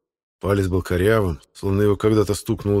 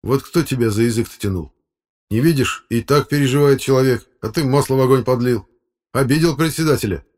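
chiding, raised pitch; reproachful; 6 semitones higher, 5 dB louder than usual. Acted. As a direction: scold a mate for going too far. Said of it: "укоризненно сказал Володька". (укоризненно, повышенным тоном)

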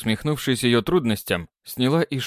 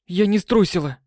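defiant; 7 semitones higher than usual. Acted. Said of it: "вдруг заявила она".

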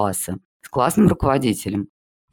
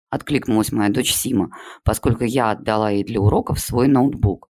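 plain reading voice

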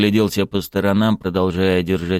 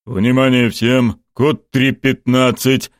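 slow, measured commander's call, pitched up; radio command; 5 semitones higher, 4 dB louder, slower than usual. A narrator's reading. General alerts all units by radio, commanding slowly. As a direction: give the code slowly and firmly. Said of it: "скомандовал генерал по рации".

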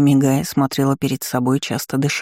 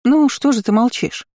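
high-pitched mocking prod; насмешливо; 8 semitones higher than usual. A narrator's reading. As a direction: tease for an answer, pitch jumping up. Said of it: "раздалось насмешливое". (насмешливый вопрос высоким тоном)

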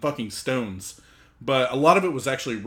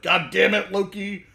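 raised, higher exasperated cry; first outburst, not yet at full yell; exasperated Thor; 8 semitones higher, 3 dB louder than usual. Acted. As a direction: curse at Loki in mock exasperation. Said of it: "then Thor's like".

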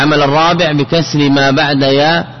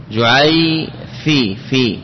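جعي في split and drawn out slowly; slow syllable demo; much slower than usual. Shown as a demonstration. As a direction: spell out جعي في slowly, pausing. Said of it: "فتقول جعي".

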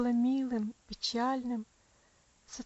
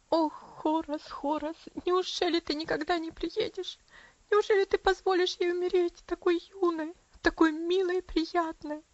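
higher, louder wailing lament; tearful lament; 7 semitones higher, 5 dB louder than usual. A narrator's reading. Aunt wringing her hands, wailing for Horace. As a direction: wail for the absent brother. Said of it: "причитала со слезами Аделаид".

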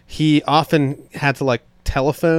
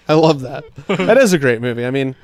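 ordinary talk

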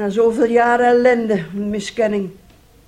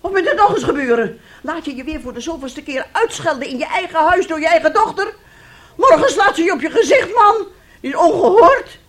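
loud, higher, outraged scolding; indignant outrage; 7 semitones higher, 5 dB louder than usual. Acted. Mother furious, urging her husband to scold their daughter. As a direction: demand he punish her.

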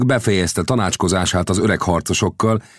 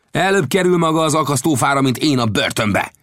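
high, raised-voice angry retort; shouted retort; 9 semitones higher than usual. A narrator's reading. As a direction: shout it at an opponent, pitched up. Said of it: "kiáltotta felém".

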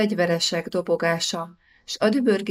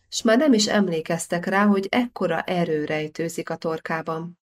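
ordinary delivery

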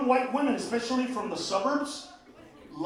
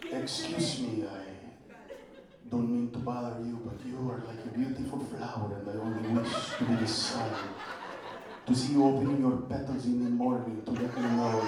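put-on seduce in a Castilian accent; Spanish accent; 9 semitones lower, faster than usual. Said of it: "the accent specifically from Spain".

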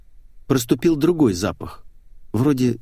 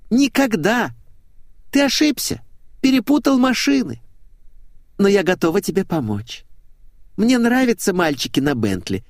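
put-on very high girlish coquette; girlish blonde, coquettish; 10 semitones higher than usual.

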